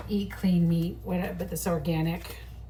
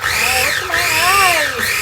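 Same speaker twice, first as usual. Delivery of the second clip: high, loud, drawn-out wail; loud onion-tear howl; pained outcry; 9 semitones higher, 11 dB louder than usual. Real, not acted. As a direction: cry out at stinging eyes. Onion fumes stinging her eyes.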